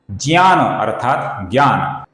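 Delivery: normal talking voice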